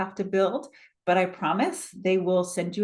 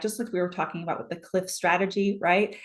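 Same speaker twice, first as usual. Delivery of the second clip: ordinary talk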